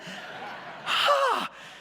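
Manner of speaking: hammed-up weary sigh at the delay; exasperated sigh. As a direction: let out a big fed-up sigh. Exasperated retelling of late flight.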